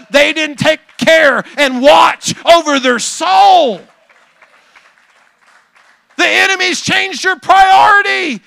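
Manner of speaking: high, loud preacher's build; preaching at full force. Acted. Pastor warns drowsy believers, preaching high and loud. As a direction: preach it hard, urgent and rising.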